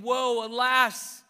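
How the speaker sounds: cry of woe. Acted. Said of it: "So he is saying".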